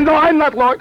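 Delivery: high, loud retraction; mock panicked denial. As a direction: take it back loudly, imitating panic. Man hurriedly takes back his claim.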